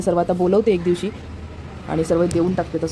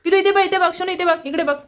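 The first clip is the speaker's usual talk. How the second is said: high, sing-song coaxing; coaxing pet call; 10 semitones higher than usual. Real, not acted. Owner gets pet to look for photo, coaxing. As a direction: call the little one's attention, pitched high.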